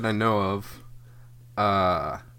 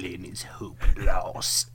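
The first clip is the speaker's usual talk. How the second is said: whisper; 3 semitones lower, slower than usual. Acted. Creepy villain line.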